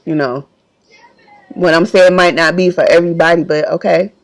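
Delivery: emotional, subdued. Real, not acted. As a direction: voice sinking with emotion, pausing often. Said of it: "I'm gonna get a little emotional".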